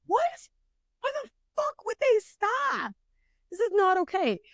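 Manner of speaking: very high, squealed disbelief; acted-out panicked outrage. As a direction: shriek it in mock horror without much volume.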